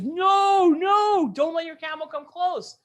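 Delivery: high, loud alarmed shout. Acted. Store owner shouts at camel driver to stop. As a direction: store owner yelling to keep the camel away.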